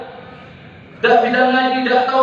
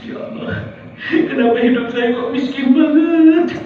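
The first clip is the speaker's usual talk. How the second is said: whiny mock complaint; 4 semitones higher than usual. Mock whining about poverty.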